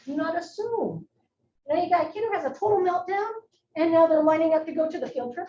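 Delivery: usual speaking voice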